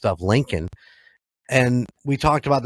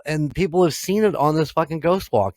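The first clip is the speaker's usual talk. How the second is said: normal talking voice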